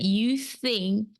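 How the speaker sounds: slow, leaning hard on "you think"; emphasis on you think. Shown as a demonstration. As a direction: stress "you think" as the key words. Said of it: "I put a lot of emphasis on the word "you think".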